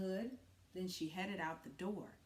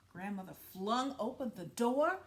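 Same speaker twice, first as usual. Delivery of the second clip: ordinary delivery, nothing marked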